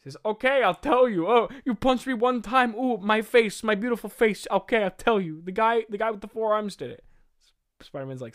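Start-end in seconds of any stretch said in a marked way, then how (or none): 0.2-7.1 loud, high panicked whining; hammed-up thug yelping after a punch; Shocker, caving in. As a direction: give in fast, comically hurt. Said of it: "screams and punches Shocker".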